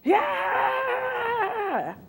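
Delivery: high, drawn-out shouted yeah; pushy candidate yelling. Retold or imitated. Candidate calling at the recruiter, high drawn-out shout. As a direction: holler like a desperate job seeker. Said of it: "if you come to me".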